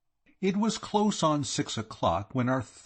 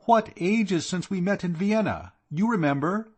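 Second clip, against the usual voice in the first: delighted hostess; 9 semitones higher, 3 dB louder than usual. Acted. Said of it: "she said".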